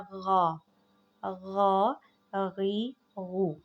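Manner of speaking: गा गी गू voiced, no scrape at all; ghain without rasp. Shown as a demonstration. Teacher correcting students, ghain kept smooth. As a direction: keep the ग़ smooth and plain, no harshness. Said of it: "गाइन जो है वो normal आपने रखना है".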